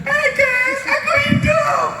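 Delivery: very high-pitched, hammed-up squeal to followers; excited status-update shout. Preacher mimicking a social media post, excited shout. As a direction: announce it to everyone, wildly overjoyed. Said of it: "Kemudian buat update status".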